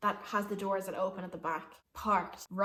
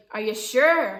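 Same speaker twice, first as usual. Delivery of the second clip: loud imitated question, higher at the end; called-out check; 4 semitones higher, 11 dB louder than usual. Bus driver checking on passengers, calling out. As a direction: call it down the length of a bus.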